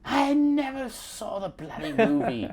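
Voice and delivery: slow, high-pitched mock British; exaggerated British accent played for laughs; fake British accent. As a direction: draw it out in a put-on British voice. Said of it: "did a British accent".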